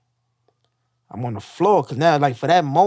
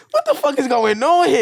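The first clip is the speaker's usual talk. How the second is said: high, raised-voice bewildered protest; retold alarmed outburst; 9 semitones higher, 4 dB louder than usual. Patient having a reality check on the ward, alarmed outburst. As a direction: replay the outraged shock, pitched way up.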